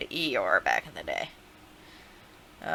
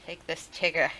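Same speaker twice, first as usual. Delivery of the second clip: usual speaking voice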